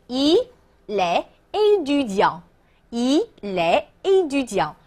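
il est run together as 'i-lè', slowly; liaison. Shown as a demonstration. Teacher demonstrates linking to learners, slow il est étudiant with liaison. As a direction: link il est into 'i-lè', then a careful étudiant. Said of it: "注意连读".

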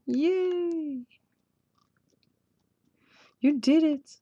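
high congratulatory cheer; bright-pitched little "yay" of congratulation. Real, not acted. Cheers viewer finishing final bands.

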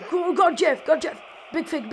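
ordinary talk, nothing marked

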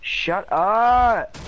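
sharp shout of shut up; sudden loud outburst. Real, not acted. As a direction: yell it at your teammate. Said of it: "I sound like the biggest guy".

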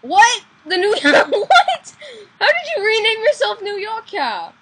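high, loud, shocked shouting; shocked disbelief. Real, not acted. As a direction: yell it in utter disbelief. Stunned by renamed player.

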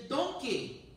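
put-on a brief start of a Scottish Shrek accent on "Donkey", high, then dropped; a hint of a Scottish accent on "Donkey". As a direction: begin "Donkey" in a Scottish ogre accent and abandon it. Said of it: "I'm not gonna do a Scottish accent".

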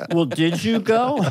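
exaggerated lilting Liverpool accent. Shown as a demonstration. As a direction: draw out the question in Liverpool singsong. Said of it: "the lilting Liverpool accent".

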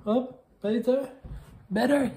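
high squeaky interjection; squeaky side voice. Acted. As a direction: pipe up in a tiny squeaky voice. A squeaky higher voice.

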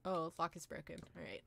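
usual speaking voice

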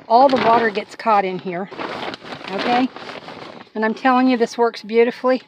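ordinary talk, nothing marked

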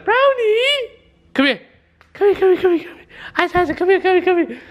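very high squeaky pet call; not-mad coaxing voice. Real, not acted. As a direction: coax a dog in excited falsetto. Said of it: "when I talk like that".

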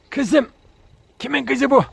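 cooing at the dog. Real, not acted. Owner cooing at his female dog, high pitched. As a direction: coo up high and loud at her.

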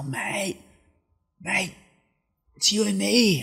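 put-on high, drunken Australian mate. Drunk Australian surfer bursting out of the bar, high Australian voice. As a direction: slur it like a drunk Aussie. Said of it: "this Australian surfer".

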